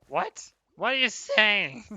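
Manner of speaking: high, loud disbelieving squeak; incredulous what. Retold or imitated. Streamer imagines Mika's report, shocked disbelief. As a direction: act baffled at what Mika says. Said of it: "I'm gonna be like".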